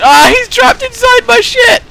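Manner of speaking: very high, loud squeal of glee; excited yell. Real, not acted. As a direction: shriek it as the trap springs. Sudden excitement at trapping an enemy.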